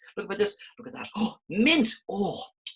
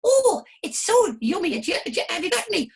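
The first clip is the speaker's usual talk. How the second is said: high, loud flower voice; squeaky flower, hurrying; high excited flower; 6 dB louder than usual. Acted. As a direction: gush like a greedy little flower.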